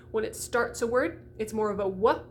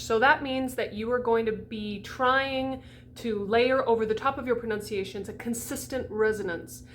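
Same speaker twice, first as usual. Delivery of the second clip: ordinary talk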